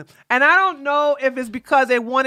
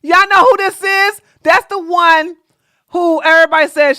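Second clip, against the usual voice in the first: excited call-out; 6 semitones higher, 6 dB louder than usual. Real, not acted. Excitedly pointing out the guest.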